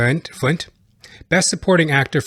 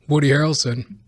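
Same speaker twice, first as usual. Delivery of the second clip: ordinary delivery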